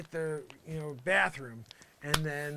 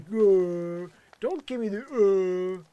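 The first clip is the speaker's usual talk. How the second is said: loud, higher mock imitation of his friend; mocking Willie; 5 semitones higher, 14 dB louder, much slower than usual. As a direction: throw his own whiny protest back at him, much louder. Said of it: "Willie, when you're like".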